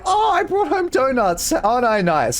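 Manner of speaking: high, self-satisfied sing-song; smug sing-song voice. Retold or imitated. Mocking a smug gift-giver.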